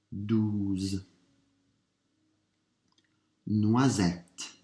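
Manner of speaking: slow, deliberate, each word spoken three times; French dictation. Shown as a demonstration. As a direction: read the words out for a listening test, clearly and unhurried.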